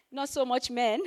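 usual speaking voice

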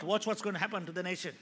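ordinary delivery

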